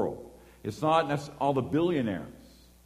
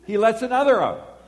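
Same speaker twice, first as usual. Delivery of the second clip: high, loud indignant repeat; can't believe he did it again; outraged disbelief; 11 semitones higher, 10 dB louder than usual. Retold or imitated. Watching the driver wave cars out, loud outrage.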